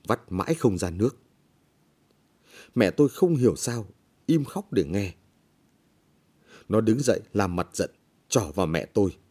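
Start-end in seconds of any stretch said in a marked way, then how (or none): none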